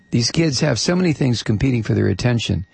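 ordinary talk

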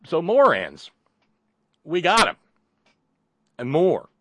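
loud, high announcer's holler; big shouted radio pitch; mock hollering. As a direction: belt it out like a hawker. Mock hawking of morons on air.

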